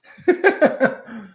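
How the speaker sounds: sudden high-pitched burst of laughter; high loud laugh. Real, not acted. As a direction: cackle at your own joke. Laughs at own joke.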